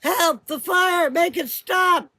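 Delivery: high, loud panicked pleading; terrified screams. A narrator's reading. Burning sisters begging for help, terrified screams. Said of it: "they were calling out".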